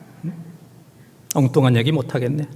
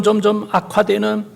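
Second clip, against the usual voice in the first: ordinary talk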